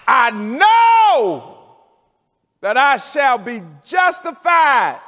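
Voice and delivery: high, loud preacher's cry; full-voiced shout. Preacher shouting Job's words to the congregation, full-voiced cry. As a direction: shout the promise out to the church.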